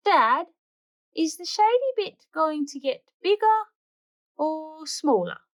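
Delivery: put-on child question. Bluey to Dad about the shade, high child question. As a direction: ask like a curious little girl.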